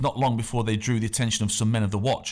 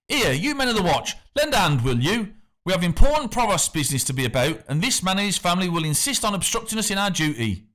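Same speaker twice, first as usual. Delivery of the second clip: higher, calling across to the watch; 8 semitones higher than usual. A narrator's reading. The factor calls the men of the watch over the canal, calling out. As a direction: hail the passing men for backup. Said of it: "he called out".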